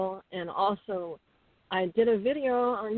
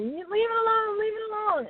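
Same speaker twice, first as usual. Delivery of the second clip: retold yell; 13 semitones higher than usual. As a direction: shout it while running to the rescue.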